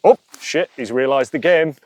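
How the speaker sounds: high, loud panicked "oh shit"; mock alarm from a cornered fear; voicing the fear. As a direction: play the fear caught out, alarmed. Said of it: "the fear goes".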